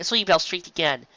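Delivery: ordinary delivery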